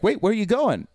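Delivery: mock panicked wait; alarmed call. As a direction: call after him, startled and confused. Panicked call to leaving doctor.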